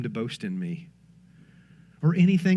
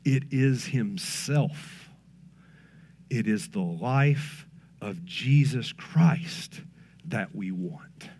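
much lower, softer and slow; hushed, slow climax; 7 semitones lower, 6 dB quieter, much slower than usual. Real, not acted. Preacher closing the point to his church, slow and hushed. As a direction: let it fall to a reverent hush.

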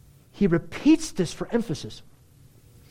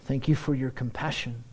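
softer, lower prayer voice; quiet prayer; 3 semitones lower, 4 dB quieter than usual. Real, not acted. Preacher praying to Jesus, softer and sincere. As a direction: pray gently to Jesus.

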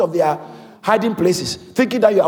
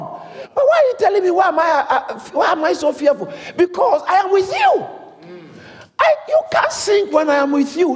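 preaching at full force; 8 semitones higher than usual. Real, not acted. Pastor tells church they can't sink, full-force preaching. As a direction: drive it home like a revival preacher.

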